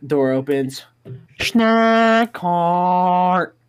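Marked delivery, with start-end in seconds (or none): 1.4-3.6 loud, high, drawn-out yelled imitation; mock yell. Teller mimics the yell that burst into math class, mock yell. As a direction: stretch both words into a piercing mimicked holler.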